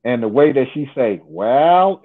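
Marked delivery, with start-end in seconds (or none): 1.2-2.0 imitated, stretched southern drawl with a rising end. Storyteller fondly imitating how she says it, southern drawl. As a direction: draw it out in a laid-back southern drawl. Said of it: "with that little southern drawl".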